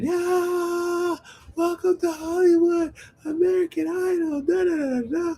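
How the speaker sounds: high, held cheer into a da-da jingle; mock announcer squealing the show's welcome; mock TV celebration. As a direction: imitate a talent-show fanfare way up high.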